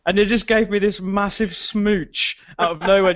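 usual speaking voice

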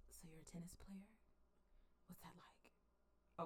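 whispered, slow, awkward question; mock whisper. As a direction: breathe it out slowly like an awkward flirt. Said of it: "Jenny finally says".

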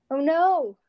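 sudden loud panicked cry. Real, not acted. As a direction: blurt it out in panic. Panic at forgetting.

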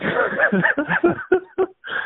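loud high-pitched laugh. Real, not acted. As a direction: burst out laughing. Laughs after saying life boring.

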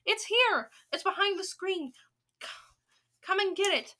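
high, loud cry of discovery; triumphant yell. Acted. Witch finding the narrator behind screen, yelling triumphantly. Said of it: "yelled triumphantly".

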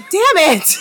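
high exclamation breaking into laughter; real exasperated yelp; frustrated outburst. Real, not acted. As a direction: burst out at your own flub, half laughing. Flubs, exclaims, then laughs.

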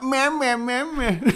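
high, loud mocking meh; mock McGregor taunt. Mocking the abusive gamer's taunt, high and loud. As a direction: taunt like a trash-talking fighter. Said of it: "it was full McGregor".

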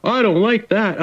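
high, whiny mock Southern grumbler; a hint of a griping Southern dad; Southern complainer voice. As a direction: whine it like a small-town grouch. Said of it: "You sound like the Southern person".

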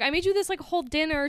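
playing Jezebel pleased with the dinner she made; sweet girlfriend voice. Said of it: "Jacob gets home and she's like".

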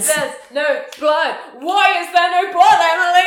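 hammed-up slow indignant delivery; mock character line.

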